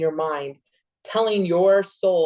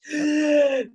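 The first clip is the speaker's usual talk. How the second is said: sudden high gasp of shock; sharp gasp; 9 semitones higher than usual. Retold or imitated. Remembering the ex, a sharp high shocked gasp. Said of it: "and you just, like".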